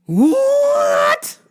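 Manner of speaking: high squealed question; mock-appalled, squeaky outburst; shocked disbelief. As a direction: shriek it incredulously, pitch shooting up. Retold shocked reaction.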